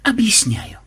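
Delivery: high, instructive lead-in; preachy explanatory tone; didactic. A narrator's reading. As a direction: begin lecturing like a schoolmaster. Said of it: "наставительно произнёс он".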